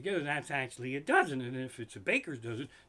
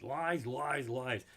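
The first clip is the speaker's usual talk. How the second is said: ordinary talk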